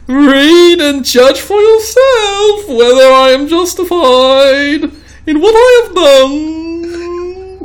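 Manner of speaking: very high, loud, slow hammy English voice; mock English accent. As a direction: wail it in an over-the-top English accent. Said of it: "it would be an English accent".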